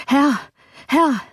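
high, alarmed cry to his master; frightened call. A narrator's reading. Terrified servant bursting in on master, frightened call. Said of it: "mit noch erschrockenerer Miene".

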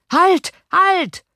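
very high, loud cry of halt; shouting. A narrator's reading. Armed men in the street shouting at the coach. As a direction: yell it to stop the charging horses.

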